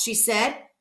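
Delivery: ordinary delivery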